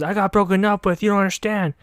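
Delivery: high-pitched imitation of an upset girl; mock upset whine. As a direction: plead your heartbreak in a high voice. Retold upset outburst after breakup.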